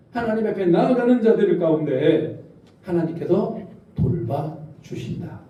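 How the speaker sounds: normal talking voice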